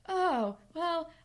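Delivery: flustered, get out fast; awkward guy. Retold or imitated. Wesley awkwardly exits conversation.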